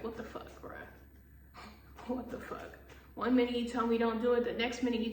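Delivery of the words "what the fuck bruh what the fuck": unvoiced, hushed what the fuck; whispered disbelief. Real, not acted. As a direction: breathe it in disbelief. Disbelief at boss.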